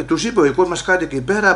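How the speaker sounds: ordinary talk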